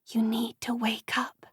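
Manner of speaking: soft breathy whisper. A narrator's reading. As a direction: whisper it from far away. Whispering in the air.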